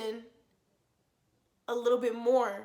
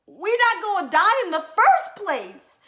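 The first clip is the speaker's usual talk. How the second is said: high, loud and slow flustered squeal; excited squeal; 8 semitones higher, 10 dB louder than usual. Retold or imitated. Replying to her date, loud high slow flustered squeal.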